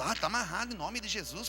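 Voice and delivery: ordinary talk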